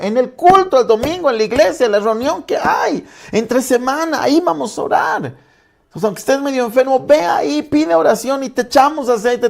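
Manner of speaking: high-pitched, insistent preaching; full-force preaching. Acted. Pastor urging the sick to come for prayer, impassioned preaching. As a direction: drive it home from the pulpit, pitched well up.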